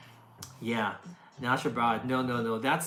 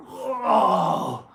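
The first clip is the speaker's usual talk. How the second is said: loud, higher gasp of delight at tasty food; imitating her delight; 5 semitones higher, 8 dB louder than usual. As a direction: mimic her over-the-top joy at a favourite bite. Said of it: "she'll be like".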